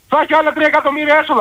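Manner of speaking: φωνάζει. Real, not acted. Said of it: "Γιατί φωνάζει εκεί".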